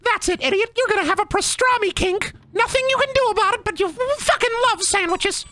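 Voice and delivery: very high, cartoon bully voice; squeaky villain. Cartoon villain cursing victim with pastrami kink, squeaky taunt.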